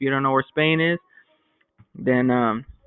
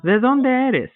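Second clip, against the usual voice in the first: higher, brighter schoolgirl question; voicing Elena; 10 semitones higher, 3 dB louder, slower than usual. Teacher voicing Elena asking Javier where he's from, higher. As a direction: lift it up as Elena asking the new kid. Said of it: "Elena goes".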